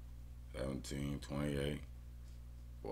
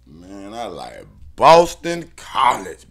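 much louder, slow, leaning on Boston; loud emphatic declaration; 5 semitones higher, 17 dB louder than usual. Real, not acted. Bettor declaring his pick Boston College, loud and slow. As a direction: announce the pick with force.

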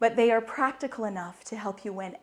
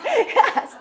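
ordinary talk

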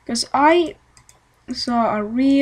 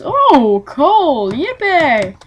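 pitched-up cheer over coal; mock excitement; 4 semitones higher, 6 dB louder, much slower than usual. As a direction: fake delight at finding coal. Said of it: "For me, it's".

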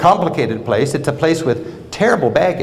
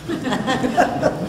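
a high, soft laugh at your own remark; quick high amused laugh; high burst of laughter; 11 semitones higher, 5 dB quieter than usual. Real, not acted. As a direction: chuckle lightly, high and airy.

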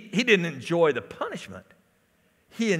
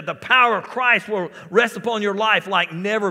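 raised, driving sermon delivery; fervent pulpit voice, higher and louder; full-force preaching; 7 semitones higher, 9 dB louder than usual. Real, not acted. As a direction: preach it loud, punching the key words.

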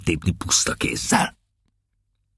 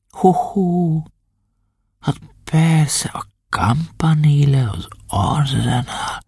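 musing; 9 semitones higher, slower than usual. Acted. Drunk captain musing on Venice.

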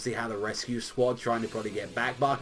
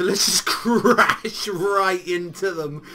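laughing through the words, slowly and louder; 6 semitones higher, 8 dB louder, much slower than usual. Real, not acted. Fan laughing while gushing over the ending, laughing. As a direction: chuckle while gushing about the crash. Gleeful about the chapter ending.

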